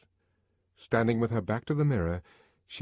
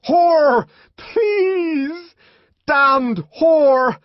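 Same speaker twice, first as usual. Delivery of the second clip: much higher, much louder raging shout; furious shout; 14 dB louder, much slower than usual. A narrator's reading. The savage to Lenina, raging shout. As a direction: roar it in blind fury.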